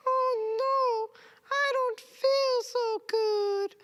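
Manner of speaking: very high, slow, whimpering; sickly cartoon whine; squeaky sick banana.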